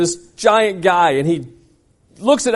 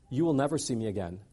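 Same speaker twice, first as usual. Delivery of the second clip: muttered vow; 7 semitones lower, 11 dB quieter than usual. Retold or imitated. Repeat of retort.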